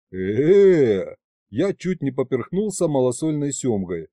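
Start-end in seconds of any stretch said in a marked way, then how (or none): 0.1-1.1 loud startled stammer; startled choked sound. A narrator's reading. Hero chokes in surprise.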